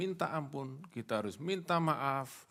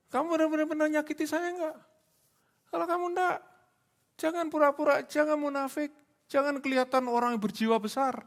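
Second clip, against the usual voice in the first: sharply raised, louder challenge; confronting; 6 dB louder than usual. Acted. Preacher challenging an insincere apologizer, forceful confrontation. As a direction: press each accusation in a high, forceful voice.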